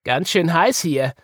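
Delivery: mildly put-out little bunny; grumbling. A narrator's reading. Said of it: "murrte Woggle".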